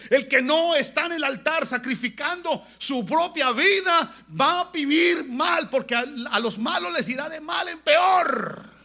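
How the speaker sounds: slow, high, shouted warning; full-force preaching. Real, not acted. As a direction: hammer out each phrase at the top of your voice.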